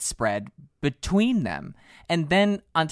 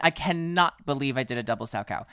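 ordinary delivery

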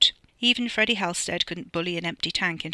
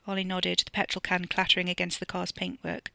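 plain reading voice

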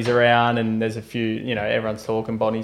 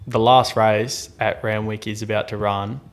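ordinary talk

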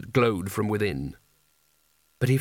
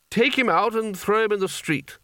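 commanding; 8 semitones higher than usual. A narrator's reading. Spelter orders porters.

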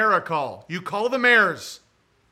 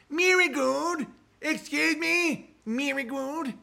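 higher comic voice to Marigold; high-pitched mock caller; lightly voiced goofy caller; 6 semitones higher, slower than usual. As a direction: call Marigold in a silly voice. Reprising comic character.

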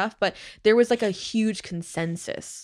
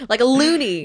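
higher and louder, amused; excited; 6 semitones higher, 7 dB louder than usual. Real, not acted. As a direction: blurt it out with glee. Laughing exaggeration.

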